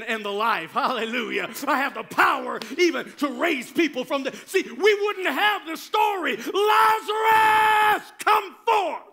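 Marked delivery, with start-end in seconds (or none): 1.7-6.4 higher, louder preaching surge; full-force preaching. Real, not acted. Preacher to congregation on power to raise people, full-force preaching. 6.4-9.0 huge drawn-out high shout; commanding call. Acted. Preacher as Jesus calling Lazarus from the grave, commanding shout.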